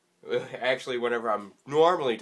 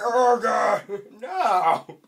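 loud, higher shouts breaking into a laugh; panicked yell; 10 semitones higher, 11 dB louder, much slower than usual. Real, not acted. Gamer yelling as his character dies. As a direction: yell in dismay then laugh.

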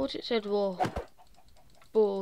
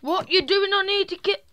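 high loud panicked yell; excited shout; 9 semitones higher, 8 dB louder, faster than usual. Real, not acted.

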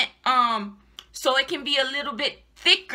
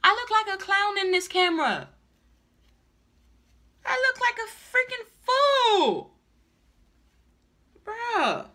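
exasperated wail; 8 semitones higher than usual. Real, not acted. Wig buyer seeing herself on camera, exasperated wail. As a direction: wail it in dismay, falling off at the end.

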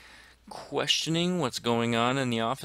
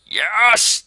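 loud, high yelp of triumph; sudden real cheer, much higher and louder; victory shout; 11 semitones higher, 11 dB louder than usual. Real, not acted. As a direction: cheer it out at full volume. The plane hits.

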